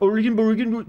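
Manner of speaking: high-pitched sing-song mumbling gibberish; mock English as mushy, lilting nonsense; high burbling imitation of garbled speech; Swedish Chef. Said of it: "the Swedish chef on".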